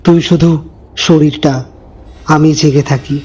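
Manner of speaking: slow, low menacing shadow. Acted. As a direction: speak it like a thing that waits in the dark. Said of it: "সে ধীরে ধীরে বলল".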